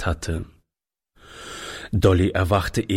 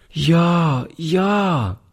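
put-on eager child's cry; 8 semitones higher than usual. A narrator's reading. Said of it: "rief die Kleine".

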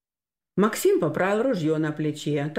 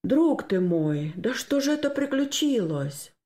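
dismayed; 6 semitones higher than usual. Acted. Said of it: "проговорил он упавшим голосом". (горестно, упавшим голосом)

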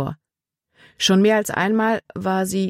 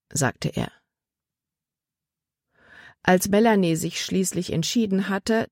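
usual speaking voice

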